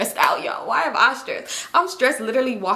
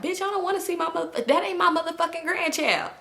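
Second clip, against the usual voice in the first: scolding mother; 6 semitones higher, 3 dB quieter than usual. Retold or imitated. Acting out mother's retort.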